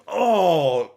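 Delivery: loud, high burst of delight; thrilled exclamation; excited oh. Real, not acted. Excited reaction.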